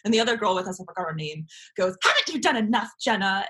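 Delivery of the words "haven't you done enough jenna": mock scolding. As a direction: retell a classmate's reproach, pitched up. Bystander scolds her over crying girl.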